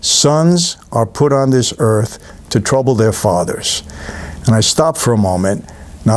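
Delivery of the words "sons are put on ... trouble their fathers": slow, grave repeat of the father's line; Paul Newman's line. Retold or imitated. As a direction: savour each word, slow and weighty. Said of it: "did you hear that?".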